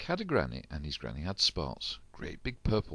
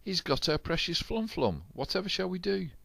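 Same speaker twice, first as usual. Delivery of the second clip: much higher wailing; high dismay; 12 semitones higher than usual. A narrator's reading. Crew losing the fruit, wailing high. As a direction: wail it in dismay.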